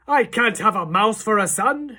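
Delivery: high, loud horrified shriek. A narrator's reading. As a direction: scream it in despair. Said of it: "shrieked Mister Jenkins".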